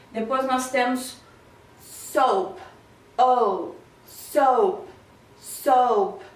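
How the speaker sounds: ordinary talk, nothing marked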